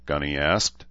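plain reading voice

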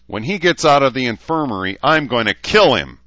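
fast, loud, high-pitched threat; screamed. A narrator's reading. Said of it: "Dean screamed".

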